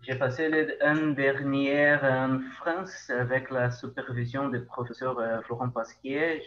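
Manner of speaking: Brazilian Portuguese accent in French; French spoken with a Brazilian accent; foreign accent in french. Real, not acted. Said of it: "mon, mon français".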